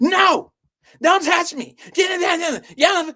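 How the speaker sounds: high-pitched mock shouting; loud, exaggerated refusal; getting all loud. Girl refusing the boy's private word, high and shouted. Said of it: "She trying to get all loud".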